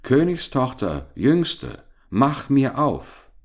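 high storybook summons; calling at the door. A narrator's reading. Frog at the door calls the princess, calling out. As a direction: call it out, drawing out the last words. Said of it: "klopfte es an der Tür und rief".

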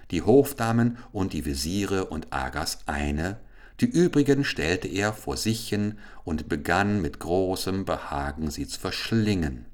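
usual speaking voice

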